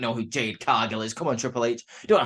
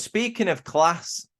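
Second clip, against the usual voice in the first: slow and drawn out, higher pitch; slow pointed line; 5 semitones higher, much slower than usual. Retold or imitated. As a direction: draw it out with a knowing hint. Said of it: "And he goes".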